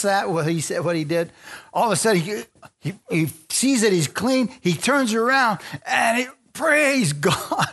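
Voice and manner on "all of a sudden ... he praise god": climbing higher and higher into praise; rising preacher's excitement; excited. Real, not acted. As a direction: build to a joyful shout of praise. Healed leper rejoicing.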